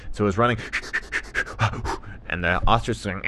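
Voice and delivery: ordinary delivery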